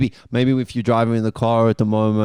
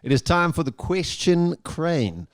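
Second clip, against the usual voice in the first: higher, slow show-host announcement; slow game show announcement; 5 semitones higher, slower than usual. Acted.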